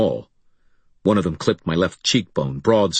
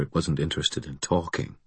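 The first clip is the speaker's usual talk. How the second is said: usual speaking voice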